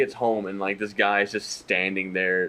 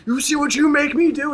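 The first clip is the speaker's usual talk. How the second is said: hammed-up husband, much higher and a bit louder; mock abusive husband; 6 dB louder than usual. Husband scolding his wife in the ad, mock high-pitched bully. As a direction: sneer it like a cartoon bully.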